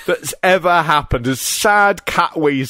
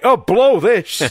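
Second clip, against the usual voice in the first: slow, raised-pitch imitation of exasperation; drawn-out, higher mock exclamation; mock fed-up outburst; 7 semitones higher, 4 dB louder, slower than usual. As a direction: imitate a wrestler who has had enough. Repeating wrestler's fed-up outburst.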